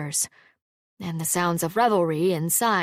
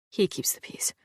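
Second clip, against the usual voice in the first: whispering; 5 dB quieter than usual. A narrator's reading. Whispered talk while hiding.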